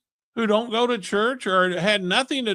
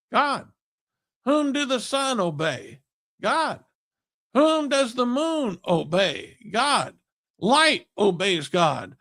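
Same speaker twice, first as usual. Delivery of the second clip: raised-pitch call-and-answer sermon cadence; rousing preaching; 4 semitones higher than usual. Real, not acted. Preacher tells listeners all creation obeys God, rousing and emphatic. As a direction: build a litany of questions and emphatic answers.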